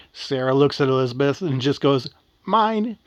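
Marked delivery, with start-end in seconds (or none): 2.4-3.0 put-on higher-pitched girl staking her claim; possessive claim. Sarah to her friend at the pot of gold, possessive claim. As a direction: say it quick, as a claim.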